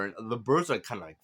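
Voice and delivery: ordinary delivery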